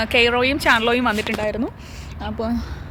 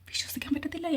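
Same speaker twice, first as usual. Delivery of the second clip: hushed aside, barely voiced; whispered disbelief; 13 dB quieter than usual. Real, not acted. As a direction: whisper it so nobody hears. Aside about brother's scolding.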